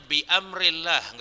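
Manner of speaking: "bi amrillah" with a glottal break after bi; careful "bi amrillah", hamza sounded; hamza pronounced. Shown as a demonstration. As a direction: keep bi apart from amrillah. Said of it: "nggak boleh".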